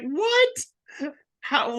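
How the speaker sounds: very high-pitched, baffled squeal; high, astonished exclamation; incredulous. Retold or imitated. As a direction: recreate utter disbelief, voice shooting way up. Retells own disbelief at the line.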